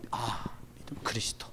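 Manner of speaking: breathy hushed aside; whisper. Acted. Pastor whispering a reminder to the congregation, hushed. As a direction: drop to a secret whisper.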